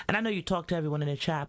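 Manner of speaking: ordinary talk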